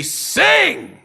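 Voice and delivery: high, loud barked order; monster's shouted command. Retold or imitated. Masked captors ordering their prisoner, menacing shout. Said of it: "and they say".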